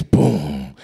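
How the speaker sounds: one deep, low thud; imitated ominous footstep. Mother coming up the stairs, ominous boom. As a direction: sound a heavy step far down in pitch. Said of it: "Up the stairs".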